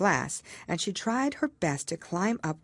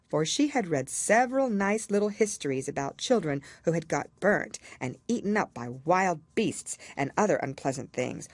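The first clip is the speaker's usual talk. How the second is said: plain reading voice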